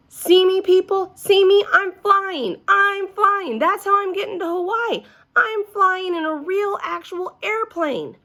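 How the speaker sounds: put-on high, thrilled kid showing off; gleeful high-pitched announcement; excited child. A narrator's reading. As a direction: call it out high and loud, bursting with news. Child zooms around showing she flies.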